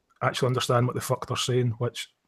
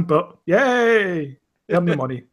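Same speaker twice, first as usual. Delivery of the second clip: mock cheer; 3 semitones higher, slower than usual. Host mocking a tennis player's success, mock cheer. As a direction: cheer in pretend celebration.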